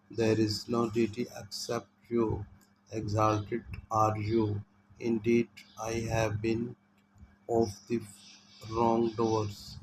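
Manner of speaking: slow, deliberate reading of the translation; slow devout English rendering. Shown as a demonstration. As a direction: read each phrase slowly and reverently.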